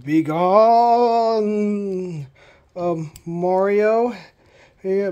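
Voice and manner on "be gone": louder, rising ghostly wail; drawn-out booming ghost command; unearthly voice. Acted. Ghost voice threatens visitors.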